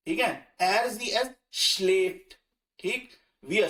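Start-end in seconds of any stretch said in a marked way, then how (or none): 0.6-2.3 er sie es schläft, very slow, schläft with an open e sound; slow demo. Shown as a demonstration. Teacher demonstrates the umlaut conjugation slowly to students. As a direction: model the umlaut form slowly. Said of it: "ए की sound देगा".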